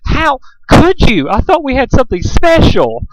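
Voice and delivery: high, slow, theatrical wail; dramatic Rarity. A narrator's reading. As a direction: play the wounded diva to the hilt. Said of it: "inhaled dramatically".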